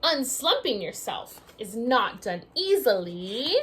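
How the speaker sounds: usual speaking voice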